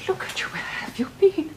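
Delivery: high, breathy worried whisper; anxious whisper. Acted. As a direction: hush it out with alarm. Mother greeting exhausted son.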